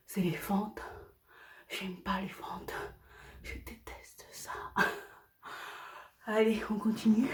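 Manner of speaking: whispered, winded after lunges; barely voicing, drained by the workout; breathless whisper. Real, not acted. Vlogger to viewers after lunges, exhausted whisper.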